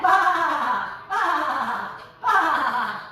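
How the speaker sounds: loud, long baas in a row; sheep bleat. Pretend. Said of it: "What does the sheep say?".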